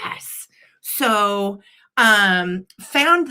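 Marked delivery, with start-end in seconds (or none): 0.0-0.5 hushed secret cheer; whispered yes. Retold or imitated. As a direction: whisper your delight so no one hears. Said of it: "I was like".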